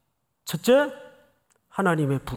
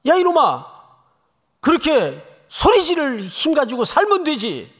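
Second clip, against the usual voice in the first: loud, higher scolding yell; angry rebuke shouted at the man on the bridge; 10 semitones higher, 6 dB louder than usual. Retold or imitated. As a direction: bark at the young man to snap out of it. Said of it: "제가 화가 나가지고".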